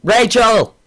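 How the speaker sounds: high, loud call; mock yell to a fan; yelled name. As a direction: yell it out as a playful shout-out. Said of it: "I need to yell at her name".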